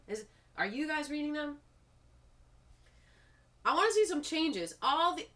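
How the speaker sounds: loud, high, exasperated pleading at the agency; frustrated outburst, voice rising well above normal; worked up. Real, not acted. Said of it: "I'm getting a little worked up".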